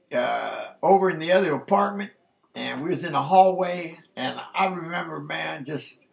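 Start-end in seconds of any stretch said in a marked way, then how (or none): none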